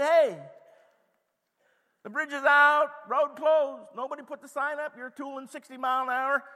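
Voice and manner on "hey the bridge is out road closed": high loud warning call; shouted warning. Pretend. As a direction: yell it like a roadside alarm. Imagined warning sign calling out.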